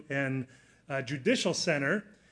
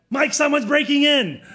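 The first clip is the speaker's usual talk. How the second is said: much higher and louder, yelling a warning; alarmed call to her husband, sharply louder; urgent shout; 11 semitones higher, 12 dB louder than usual. Retold or imitated. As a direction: shout it out to the next room. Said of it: "So very calmly, she said".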